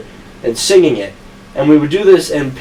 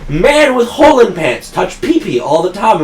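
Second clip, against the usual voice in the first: mock sage proverb; 7 semitones higher than usual. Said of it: "he would be telling me".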